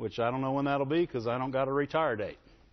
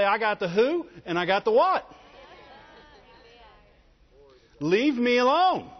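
defiant; 6 semitones higher, 3 dB louder than usual. Pretend. Answering the devil defiantly.

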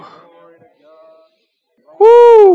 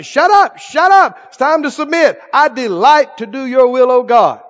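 telling flesh to shut up; 2 semitones higher, 4 dB louder than usual. Acted. Believer silencing his flesh, then loud declaration of delight. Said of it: "You say".

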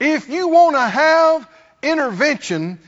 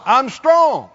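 bold declaration; 4 dB louder than usual. Pretend. Preacher voicing the weak man's confident claim. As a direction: declare it firm and sure, a bit louder. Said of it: "Let the weak say".

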